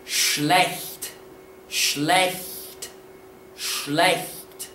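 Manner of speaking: spaced, careful "schlecht", sh at the start; model pronunciation. Shown as a demonstration. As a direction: say "schlecht" deliberately, word by word. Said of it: "correct pronunciation of this word".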